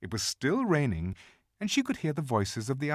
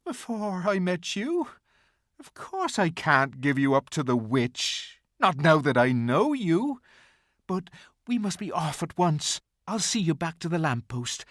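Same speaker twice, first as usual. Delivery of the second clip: a gentle faun giving in; 7 semitones higher, 6 dB louder than usual. A narrator's reading. Faun's character voice.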